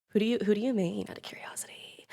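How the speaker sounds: unvoiced, hushed question; whisper. Real, not acted. Streamer asking a viewer their main, in a whisper. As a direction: lean in and whisper it.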